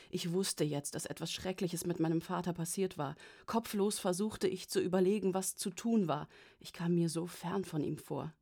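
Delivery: ordinary delivery, nothing marked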